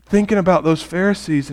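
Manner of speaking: usual speaking voice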